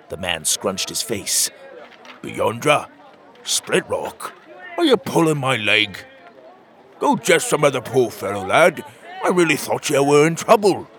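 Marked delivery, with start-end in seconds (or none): 2.2-10.9 incredulous man, building to loud annoyance; offended local man, louder towards the end; scornful bearded townsman. A narrator's reading. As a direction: start disbelieving, then send the lad off indignantly. Man scornful, thinks boy is joking.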